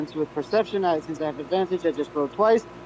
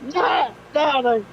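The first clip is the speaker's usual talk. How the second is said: high alarmed no; 9 semitones higher, 4 dB louder, much slower than usual. Real, not acted. Reacts to ship.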